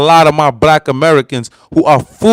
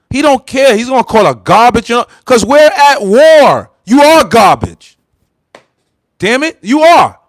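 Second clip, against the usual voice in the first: heated; 5 semitones higher, 3 dB louder than usual. Acted. Voicing wartime insults with heat.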